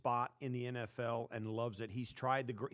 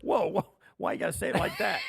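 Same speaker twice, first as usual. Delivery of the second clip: loud, high, quick objection; mock-offended comeback to a teasing host; jokey protest; 7 semitones higher, 9 dB louder than usual. Real, not acted. Contestant protests the host's teasing praise.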